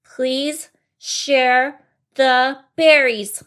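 loud, very slow, word by word; angry. Acted. Bunny demanding berries from mouse, angry slow delivery. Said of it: "Little Bunny Foo Foo said angrily".

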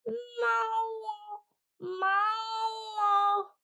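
high meowing shout; cat cry. Acted. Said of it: "They thought the cat had said".

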